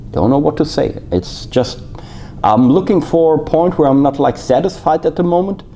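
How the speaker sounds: words around a cigar; talking with cigar in mouth. Real, not acted. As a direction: lips on cigar while speaking. Seen 1: Cigar held at lips while he talks, briefly lowered near 6.9.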